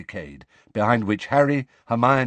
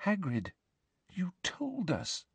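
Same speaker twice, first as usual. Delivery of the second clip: frightened; 8 semitones higher, 10 dB quieter than usual. A narrator's reading. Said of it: "her wand now shaking in her hand".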